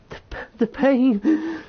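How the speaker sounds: choking up on the word pain; real tears breaking through. Real, not acted. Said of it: "I don't know why I'm getting upset".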